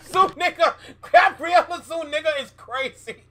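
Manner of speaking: loud, high laughing words; cracking up while saying it. Real, not acted. Laughing hard at clip.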